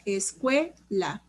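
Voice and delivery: "Escuela" slowly syllable by syllable, "cue" drawn out; stress on CUE. Shown as a demonstration. Teacher quizzing class on the tonic syllable, "Escuela" slow, stressing "cue". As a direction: break up "Escuela", leaning on "cue". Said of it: "¿Cuál es la sílaba tónica?".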